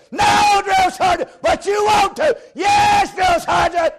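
screamed answer to a drill sergeant; recruit shouting. Retold or imitated. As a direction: yell it back at attention.

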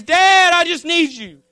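loud, high cry to Dad; pleading, begging son. Acted. Son cries out to his father for help, begging. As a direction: burst out begging at full voice. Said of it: "he's pleading and he's begging".

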